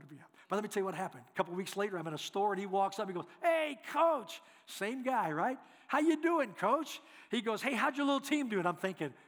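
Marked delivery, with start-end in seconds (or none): 3.4-4.4 loud, high, friendly hail; hearty greeting. Retold or imitated. Man hails coach in a store. 5.9-7.0 loud, high, friendly hail; hearty greeting. Retold or imitated. Same man greets coach amiably. 7.6-8.6 loud, high, chummy question; hearty small talk. Retold or imitated. Same man chats with coach amiably.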